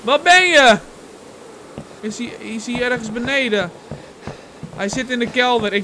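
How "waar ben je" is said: high, loud shout back; calling out. Acted. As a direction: yell to someone far away that help is coming. Answering the friend's cry for help.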